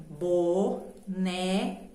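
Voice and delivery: "Boneca" very slowly as bo... ne... ca; drawn-out "Boneca" with gaps between syllables. Shown as a demonstration. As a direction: open up separately for each syllable of "Boneca". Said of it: "Eu abri três vezes a minha boca".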